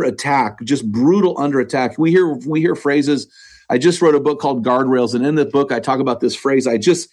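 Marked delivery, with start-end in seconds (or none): none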